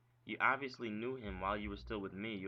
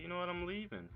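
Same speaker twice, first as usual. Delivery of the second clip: raised, higher fed-up "I'm leaving"; heated; 9 semitones higher than usual. Retold or imitated. To girlfriend on the phone, fed up and heated. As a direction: snap it out, done with her. Retold threat, now heated.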